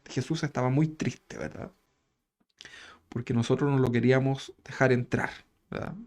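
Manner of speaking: usual speaking voice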